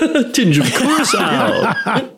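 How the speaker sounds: high, drawn-out mock delight; hammed-up echo. Friend tops the tinge of orange riff, hammed-up. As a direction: echo it slow and high through laughter.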